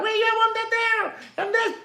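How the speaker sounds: high, thin mumbling Popeye. Retold or imitated. Mocking Popeye to his followers, high cartoon voice. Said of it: "You know Popeye".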